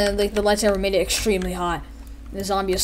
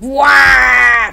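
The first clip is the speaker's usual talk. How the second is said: mock cry; 3 semitones higher, 9 dB louder than usual.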